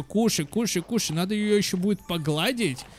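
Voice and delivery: ordinary talk, nothing marked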